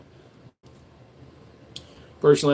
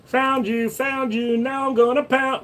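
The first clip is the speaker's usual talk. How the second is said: high sing-song taunt; 12 semitones higher, slower than usual. Pretend.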